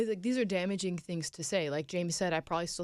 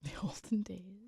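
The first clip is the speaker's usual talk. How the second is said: hushed, slow whisper; whispered echo; 7 dB quieter, slower than usual. Real, not acted. Co-host echoing the other speaker's phrase, whispered. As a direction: repeat it slowly under your breath.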